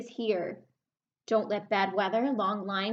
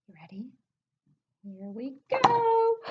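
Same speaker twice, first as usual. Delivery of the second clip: suspenseful build; 3 semitones higher, 4 dB louder than usual. Pretend. Building suspense before the reveal.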